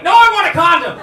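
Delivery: high, loud comic yell; panicked refusal. Acted. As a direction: shout it in mock alarm. Acted alarmed refusal of pizza trap.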